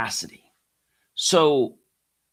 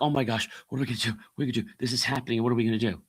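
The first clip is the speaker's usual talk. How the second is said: fast, flustered mock panic; mock panicked people; 3 dB quieter, much faster than usual. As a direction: rattle it off like a frightened crowd. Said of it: "so many people are like".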